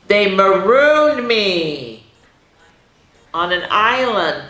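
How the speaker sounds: "marooned" drawn out slowly, falling; slow dramatic read. Shown as a demonstration. Teacher reading the marooned line slowly to the kids. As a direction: stretch "marooned" like a sad tale. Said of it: "left the little kid".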